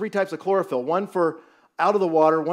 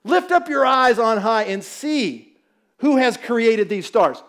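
slow, solemn reading of Isaiah; a preacher reading Isaiah aloud to the congregation, slow; scripture reading; 4 semitones higher, 5 dB louder, slower than usual. Real, not acted. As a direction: read it slowly, letting it land. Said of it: "look at this passage".

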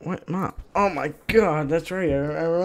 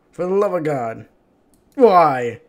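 ordinary talk

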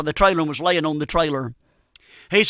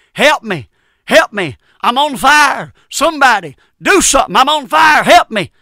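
screaming, higher and louder, for help; retold screaming; 5 semitones higher, 6 dB louder than usual. Trucker trapped in a burning cab screams for help. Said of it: "he was screaming".

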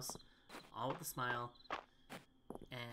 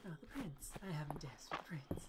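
whispering; 6 dB quieter than usual. Pretend. Midnight, Cinderella scene.

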